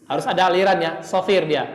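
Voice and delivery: normal talking voice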